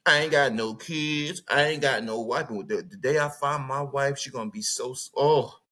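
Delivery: normal talking voice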